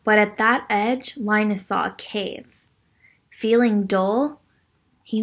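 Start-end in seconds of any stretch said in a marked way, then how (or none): none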